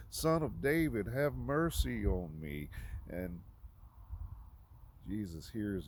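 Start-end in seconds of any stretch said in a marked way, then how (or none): 0.1-2.7 higher, louder pleading call; louder retold cry. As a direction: cry out more insistently, drawing out the plea. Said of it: "made him cry out even more".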